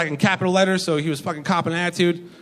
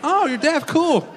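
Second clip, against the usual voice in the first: mock cheery question; 8 semitones higher, slower than usual. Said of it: "I almost really asked her that".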